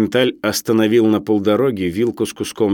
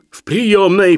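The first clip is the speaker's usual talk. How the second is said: ordinary delivery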